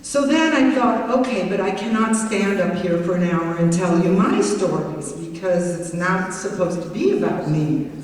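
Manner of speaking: usual speaking voice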